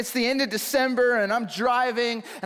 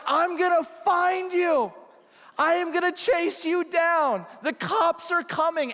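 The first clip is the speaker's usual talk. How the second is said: angry retold threats; 9 semitones higher than usual. Pastor chasing through field, retold angry shouting. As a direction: vow to hunt them down. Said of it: "And now I'm like".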